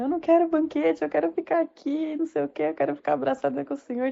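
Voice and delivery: imitated tearful pleading. Girl refusing the banquet to stay with Father, imitated tearful plea. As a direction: plead like a clingy child in tears, acted.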